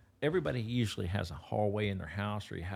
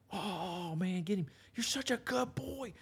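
put-on very high, excited baby-talk praise to the puppy; 11 semitones higher than usual. Shown as a demonstration. Trainer praising the puppy for bringing the sock, squeaky gushing. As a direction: gush over the pup in a squeaky voice. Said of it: "you can't overdo the praise".

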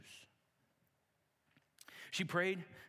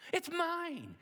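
put-on excited child yell; 6 dB louder than usual. Girl claiming the sweater.

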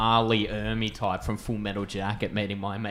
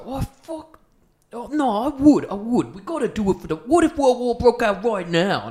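slack jawed; 13 semitones higher, 7 dB louder than usual. Retold or imitated. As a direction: drag it out like a dopey drunk. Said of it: "There's a real deep, dopey voice".

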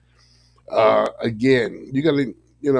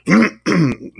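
loud rough clearing of the throat; 8 semitones higher, 9 dB louder than usual. Real, not acted. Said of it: "frog in my throat".

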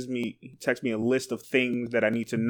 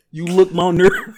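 mock Tyler line; 4 semitones higher, 6 dB louder than usual. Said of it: "but was like".

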